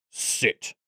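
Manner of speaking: unvoiced-sounding, terse goblin order; hushed command. A narrator's reading. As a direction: give the order almost in a whisper. Goblin orders Bumcake to sit.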